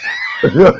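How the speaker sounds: very high, loud shrieking laughter; laughing hard at the memory. Real, not acted. Laughing at own story.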